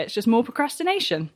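normal talking voice

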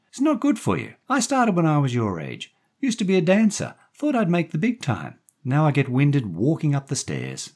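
high, loud woman's voice; chatty woman character. A narrator's reading. Daphne offering the boy a drink, chatty woman.

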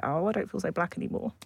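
ordinary talk

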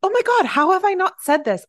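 high, loud excited outburst; excited burst. Real, not acted. Host suddenly remembers meeting a celebrity, excited. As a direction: blurt it out, suddenly thrilled.

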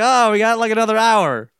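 mock shouting in a windy car; calling over wind. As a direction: call out over the rushing wind. Voicing oblivious clone over the wind.

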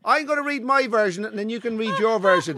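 high, pleading protest; high insistent no. Real, not acted. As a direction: keep pushing over him. Insisting over friend.

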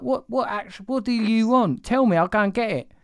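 imitating a desperate parent's high plea; exasperated pleading. Dad begging his unhappy child to say what she wants, exasperated pleading.

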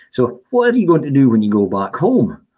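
lightly voiced eager radio man; radio interviewer. Radio interviewer quoted in story.

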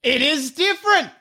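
high-pitched amazed announcement; excited discovery. Real, not acted. Friend finds a second pronunciation, excited discovery. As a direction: announce it as a thrilling find.

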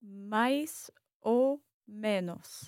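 "mais", "ou", "menos" slowly, one at a time; very slow word-by-word repeat; three separate words. Shown as a demonstration. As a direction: draw out each word of "mais ou menos", pausing between. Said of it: "there are three words here".

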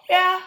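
"Yeah" pitched high and loud like a question; questioning answer. Shown as a demonstration. Showing friends her standard punctuation, loud questioning "Yeah". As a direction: punch "Yeah" up into an exaggerated question mark. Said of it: "standard punctuation".

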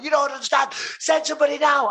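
high, desperate acted shout; panicked screaming. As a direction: plead urgently with the operator. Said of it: "I'm screaming".

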